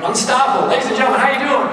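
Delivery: high, punchy call to the audience; rally the room for a shouted response; crowd hype. Real, not acted.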